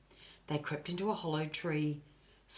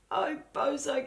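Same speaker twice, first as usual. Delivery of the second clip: higher, louder anxious cry; distressed cry; 9 semitones higher, 4 dB louder than usual. A narrator's reading. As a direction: call out in dismay to the dog. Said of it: "cried Annie".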